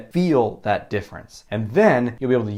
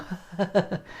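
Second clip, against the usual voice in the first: short laugh; 8 semitones higher, 5 dB quieter than usual. Real, not acted. Laughs at own impression.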